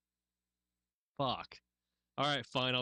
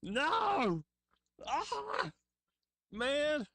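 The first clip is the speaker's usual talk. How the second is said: startled yelling; much slower than usual. Real, not acted. Jump scared while watching video.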